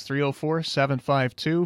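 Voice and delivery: ordinary talk, nothing marked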